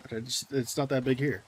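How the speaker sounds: ordinary talk, nothing marked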